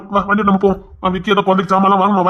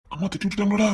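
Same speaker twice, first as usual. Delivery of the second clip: normal talking voice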